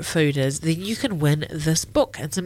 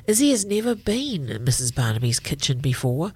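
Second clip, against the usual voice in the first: usual speaking voice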